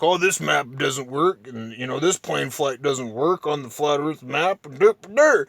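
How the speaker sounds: a dismissive flat earther done in a mocking tone; mocking imitation. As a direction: brush it off like a know-it-all. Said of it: "and he's like".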